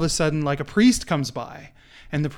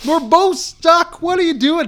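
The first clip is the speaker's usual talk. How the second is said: much higher and louder, half-laughing, incredulous; exasperated disbelief; 14 semitones higher, 11 dB louder than usual. Retold or imitated. Man in the hole yelling at Joe for jumping in, incredulous shout. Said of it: "he's like".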